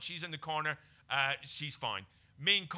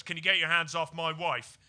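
a half-hearted tough-guy warning, a touch louder; unconvincing hard man; 2 semitones higher, 7 dB louder than usual. Retold or imitated. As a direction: play a weak attempt at a hard man. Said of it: "his least convincing role ... being hard man".

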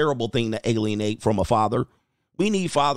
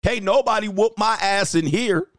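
alpha boast; 6 semitones higher than usual. Retold or imitated. Coach mimicking a bragging contractor, raised tough voice. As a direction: puff up like the alpha. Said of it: "alpha monkey noise".

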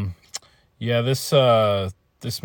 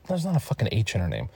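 ordinary talk

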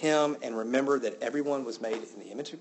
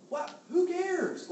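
incredulous; much slower than usual. Real, not acted. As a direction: scoff at the very idea. Incredulous reaction to own point.

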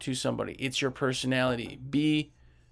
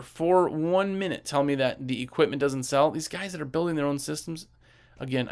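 ordinary talk, nothing marked

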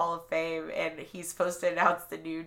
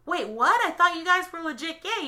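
mock confused fan; 9 semitones higher, 4 dB louder than usual. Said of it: "it's like".

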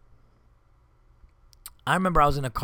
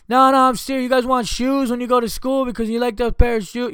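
higher and louder, I'm-serious insistence; insistent dad; 10 semitones higher, 7 dB louder than usual. Retold or imitated. Dad insisting to the kids he's serious, raised voice. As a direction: hammer home that he means it.

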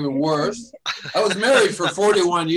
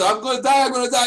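put-on panicked kid; 7 semitones higher, 4 dB louder, faster than usual.

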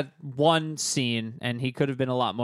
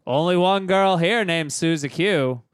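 creepy janitor voice; 4 dB louder than usual. Retold or imitated. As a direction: lean on the broom and creep them out. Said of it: "you think that I'm exaggerating these lines".

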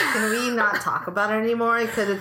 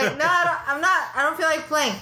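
put-on whiny child voice; 6 semitones higher than usual. Child replying.

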